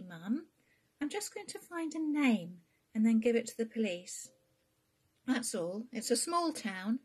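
a daughter insisting she can handle it; insistent daughter. Acted. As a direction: build up to a determined claim. Bea reassures and insists.

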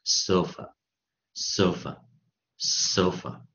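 sofa, sofa, sofa, slow with a long O. Shown as a demonstration. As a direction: stretch the O of sofa. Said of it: "是会念什么".

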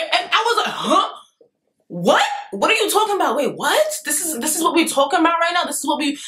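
high-pitched, disbelieving rapid questions; mock incredulous outburst. Girl wanting to laugh in his face, incredulous. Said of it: "scream, laugh in his face".

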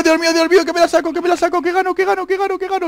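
high-pitched gabbling on the brink of winning; loud start, then squeal on at high pitch; real giddy panic; frantic excitement. Real, not acted. About to win the match.

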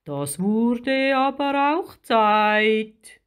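slow giant creature voice, climbing in pitch; big, drawn-out grumble; cave creature. A narrator's reading. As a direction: stretch it out like a huge creature. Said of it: "sagte der Bewohner der Höhle".

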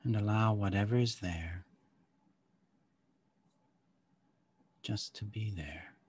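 calm guide easing them inward; guided meditation. Acted.

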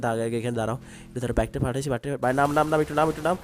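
usual speaking voice

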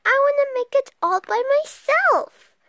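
high, loud, insistent plea; loud begging, pitched high; crying out. A narrator's reading. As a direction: insist loudly like an eager little frog. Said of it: "cried Froggy".

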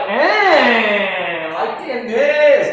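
drawn-out mock reaction; exaggerated pupil impression; Josh. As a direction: stretch it out, imitating a pupil. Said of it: "Josh is like".

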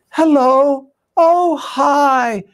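mom's sugary phone voice, pitched high; imitating a mother answering the phone all sweetness; sweet phone voice. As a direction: switch to syrupy sweetness, as if nothing happened. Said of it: "So sweet, so kind".

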